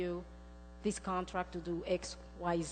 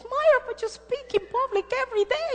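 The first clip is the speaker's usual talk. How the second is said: much higher, louder disbelief; incredulous friend; 10 dB louder than usual. Retold or imitated. Friend protesting that she speaks in public daily, loud and high. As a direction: protest like she can't believe it. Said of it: "She goes".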